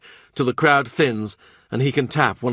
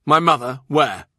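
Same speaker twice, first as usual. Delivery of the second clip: startled; 4 semitones higher, 5 dB louder than usual. Acted. King startled by vision.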